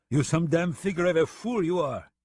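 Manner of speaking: scornful French gunman, pitched high; mock him in a French accent; strange French accent. French gunman scolds the deputy, scornful French accent. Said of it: "said Raoul in his strange accent".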